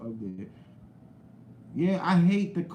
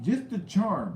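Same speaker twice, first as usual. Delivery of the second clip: usual speaking voice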